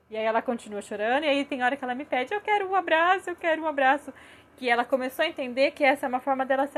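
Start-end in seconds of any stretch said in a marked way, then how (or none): 2.3-4.1 put-on higher-pitched little child's plea; imitated pleading child. Crying daughter asking mom for a hug, imitated high plea. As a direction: mimic a child asking for comfort, voice lifted.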